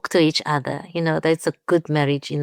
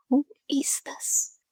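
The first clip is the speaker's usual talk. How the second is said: breathy whispered question, pitched up and slow; puzzled whisper; 9 semitones higher, 6 dB quieter, slower than usual. Retold or imitated. Retelling a late-night call from a stranger, whispered puzzlement. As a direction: whisper it, baffled by the late caller. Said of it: "And I was like".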